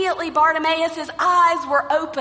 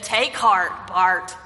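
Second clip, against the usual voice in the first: reluctant; 6 semitones lower than usual. Acted. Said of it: "reluctantly went to Bartimaeus and said".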